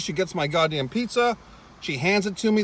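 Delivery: normal talking voice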